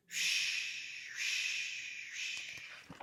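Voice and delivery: whispered shh of the wind in the grass, fading away; rustling grass sound. A narrator's reading. Grass answering the mouse with a fading whispered shh. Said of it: "repeating the same old rustling sound".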